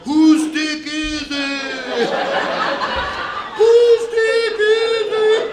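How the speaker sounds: high, slow, loud wailing ghost. Retold or imitated. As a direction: moan it out like a spooky spirit. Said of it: "she starts doing that ghost voice".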